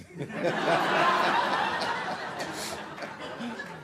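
long helpless laugh. Real, not acted. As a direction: crack up without words. Cracking up at the joke.

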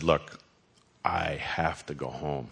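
low, soft and slow, half-whispered; retold whisper. Husband quietly telling his wife at dinner, soft and slow. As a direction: hush it so only she hears. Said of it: "I kinda whisper to her".

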